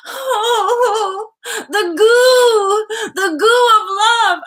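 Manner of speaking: high, loud drawn-out squeal; hammed-up lovestruck wail; gushing swoon. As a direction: melt into a squealing coo. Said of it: "when you see them, you're like".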